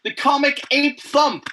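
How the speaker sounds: slow, high, hammed-up ape voice; comic ape. Shown as a demonstration. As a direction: play the cartoon ape, big and slow. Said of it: "This is the, the comic".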